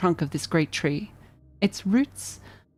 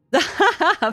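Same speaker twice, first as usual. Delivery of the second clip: high laugh; 11 semitones higher, 3 dB louder than usual. Real, not acted. Laughs giving up on voice.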